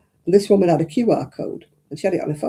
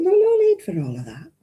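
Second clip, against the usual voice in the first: dismissive high exclamation; 12 semitones higher than usual. Retold or imitated. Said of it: "I said".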